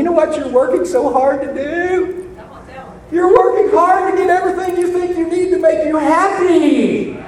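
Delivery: put-on fiery sermon warning the young; preaching at full force. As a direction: drive it home to the young.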